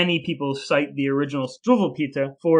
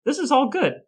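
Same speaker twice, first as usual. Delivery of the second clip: sarcastic cheer; 10 semitones higher than usual. Acted. Sarcastic setup before dark story.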